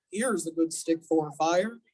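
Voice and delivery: a gruff stranger picking firewood; deep stranger's voice. A narrator's reading. A stranger's voice by the fire.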